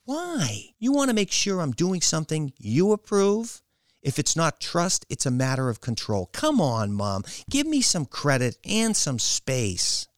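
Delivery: high, emphatic protest to mother; defiant daughter. Acted. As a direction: push back for some space.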